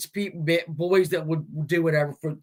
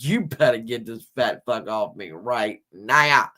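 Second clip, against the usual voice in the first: a deeper, lightly voiced horse making a threat; low horse threat; 7 semitones lower than usual. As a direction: growl it low like a fed-up horse. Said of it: "This horse is like".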